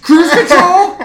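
high, loud mock yell; retold yelling. Reenacting his comeback to the driver, high mock yell. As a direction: yell it back as an incredulous question. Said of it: "I'm just keep yelling that".

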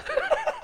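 a sudden squeaky laugh jumping way up; high laugh burst. Real, not acted. Bursts out laughing at own story.

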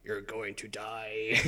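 put-on a child quoting a monster villain; quoting Bowser. Nephew quoting Bowser to strangers, lightly villainous. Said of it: "will quote Bowser".

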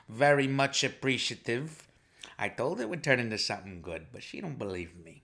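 put-on easygoing New York Italian wise guy accent, held throughout; a mobster-style podcast host chatting in his New York Italian accent. A wise guy host greets his listeners in a New York Italian accent.

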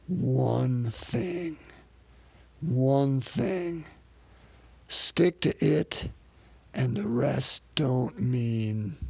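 put-on slow old cowboy, long pauses; rough cowboy. Old cowboy hands down life's secret, slow and rough. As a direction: measure it out like a weathered cowhand. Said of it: "in his rough cowboy voice".

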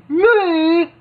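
hammed-up panic; shocked Mean Gene. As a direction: squeal in horror at the idea. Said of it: "Gene is like".

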